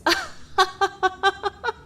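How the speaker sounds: high, drawn-out villainess cackle; mocking cackle. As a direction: cackle at an absurd plea. Mocking the wish to join them.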